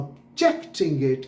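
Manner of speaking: slow ob-JECT-ing in 'Objecting'; stress on JECT. Shown as a demonstration. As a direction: lean on the middle syllable of 'Objecting'. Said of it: "कहना है". ('Objecting' में JECT पर ज़ोर)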